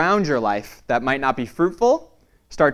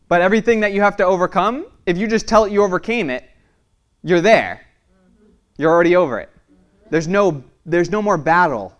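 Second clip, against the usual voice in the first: preaching; 6 semitones higher, 6 dB louder, faster than usual. Acted. Preaching builds to a peak.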